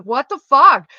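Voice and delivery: sudden loud outburst, pitch jumping up; lost it. Retold or imitated. Daughter in the car snaps at her mom, shouting. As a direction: blurt it out like you just snapped. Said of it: "Like, I lost my shit".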